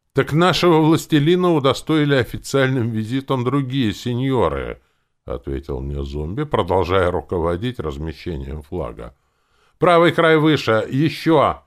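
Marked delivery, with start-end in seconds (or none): 0.1-4.8 high, projected announcement; loud answer. A narrator's reading. Zombie answering the hero while directing flag-hanging, loud. 9.8-11.6 loud, high-pitched commands; calling out orders. A narrator's reading. Zombie directing men hanging a flag, calling out.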